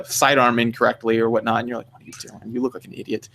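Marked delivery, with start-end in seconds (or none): 1.9-3.3 quiet, low aside in mock disbelief; retold low, muttered scolding. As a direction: mutter it under your breath. Retold reaction to posers' mistakes.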